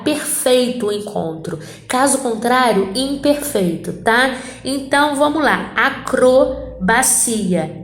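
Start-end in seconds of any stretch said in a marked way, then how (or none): 5.8-7.8 slow syllable reading. Shown as a demonstration. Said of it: "C R na mesma sílaba".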